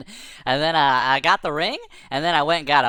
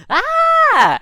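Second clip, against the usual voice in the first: loud, very high imitated shriek; monkey's scream; 11 dB louder than usual. Monkey hit by horseradish.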